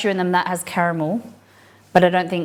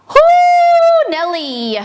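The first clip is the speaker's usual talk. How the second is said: excited whoop; 8 dB louder, much slower than usual. Real, not acted. Reacting to very spicy chili.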